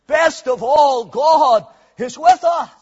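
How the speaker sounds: loud, slow, ringing proclamation; preaching quote. Acted. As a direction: proclaim it to the congregation with full force. Quotes Wesley's dying words in full preaching voice.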